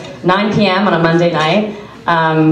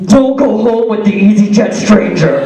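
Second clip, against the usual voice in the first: slow, louder stern warning; dad's warning voice; 3 semitones higher, 4 dB louder than usual. Retold or imitated. Dad's voice in her head warning her, slow and stern. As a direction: play the protective dad, slowly. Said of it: "I have my dad's voice".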